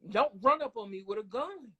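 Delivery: ordinary talk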